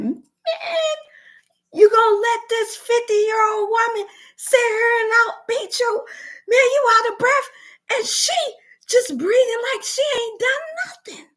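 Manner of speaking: high, loud mocking taunt; teasing friend. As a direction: rib him for being out of breath. Nita teasing the young man.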